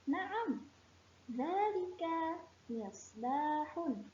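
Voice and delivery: model answer. Shown as a demonstration. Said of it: "Maka jawabannya".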